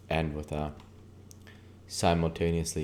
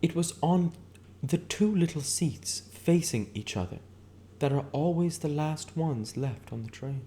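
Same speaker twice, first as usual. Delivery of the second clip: much higher woman's voice, wistful; Myrtle, dreamy reminiscence; 11 semitones higher than usual. Acted. Myrtle telling Nick how she met Tom, higher dreamy voice. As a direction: pitch way up and drift into the memory.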